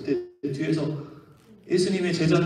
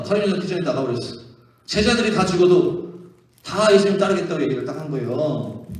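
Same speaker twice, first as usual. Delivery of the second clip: ordinary talk, nothing marked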